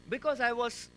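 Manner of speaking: usual speaking voice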